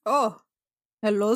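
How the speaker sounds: ordinary talk, nothing marked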